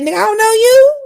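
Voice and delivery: loud, high, laughing retort. Retold or imitated. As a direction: shout it back with a laugh. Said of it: "She got basically said".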